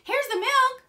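very high, loud cry; excited shout. A narrator's reading. Jack announces the milk to his mother, excited shout. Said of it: "shouted Jack".

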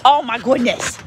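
ordinary talk, nothing marked

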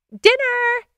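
high, drawn-out holler; sudden dinner call. A narrator's reading. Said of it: "suddenness of the declaration".

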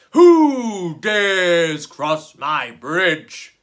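slow, loud, booming troll voice. A narrator's reading. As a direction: boom it out like a huge troll guarding his bridge. Troll challenges the second goat.

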